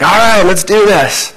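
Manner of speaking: high, slow, fired-up "let's do this"; eager Peter. Retold or imitated. Peter rallying the disciples to follow Jesus, high and eager. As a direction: pump yourself up like impulsive Peter. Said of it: "He's like".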